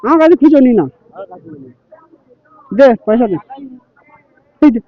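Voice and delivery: loud demanding shout. Real, not acted. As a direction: yell for the money. Arguing over ticket money.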